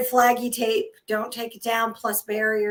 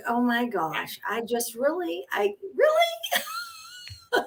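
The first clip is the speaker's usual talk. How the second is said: laughing disbelief; 6 semitones higher, 7 dB quieter, slower than usual. Retold or imitated. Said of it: "and I'm just like".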